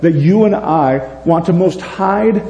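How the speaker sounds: ordinary talk, nothing marked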